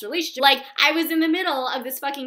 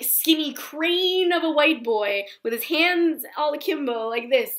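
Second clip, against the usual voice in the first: ordinary talk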